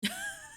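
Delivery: quick high-pitched giggle; 高い笑い声; squeaky laugh. Real, not acted. Laughing at the model.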